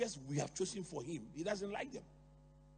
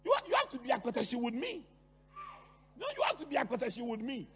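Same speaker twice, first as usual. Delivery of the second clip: bitter friend; 6 semitones higher, 6 dB louder, faster than usual. Retold or imitated. Bitter people voiced, demanding.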